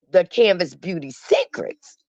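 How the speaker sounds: ordinary talk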